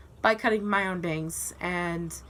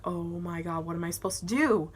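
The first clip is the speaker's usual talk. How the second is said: ordinary talk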